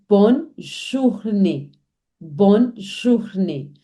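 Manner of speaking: Bonne journée said slowly, stress on "bonne"; slow down and model Bonne journée. Shown as a demonstration. Teacher slowly modelling Bonne journée for learners. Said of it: "E with an accent aigu".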